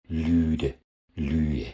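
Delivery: Lüde with a D, then Lühe with the D dropped; weakened D. Shown as a demonstration. Teacher shows D weakening between vowels, contrast demo. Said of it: "intervokalisch schwach und fällt häufig aus".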